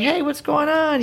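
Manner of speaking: high-pitched, chirpy call of hello; acted breezy greeting. Actress greeting a man she thinks is asleep, breezy. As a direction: play a bright, casual greeting in a scene. Acting out her scene's greeting.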